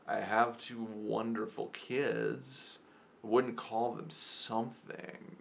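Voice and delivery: slow, drawn-out retold retort; imitating her delivery. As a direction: stretch each word out in imitation of her. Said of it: "to an imitation, but she was like".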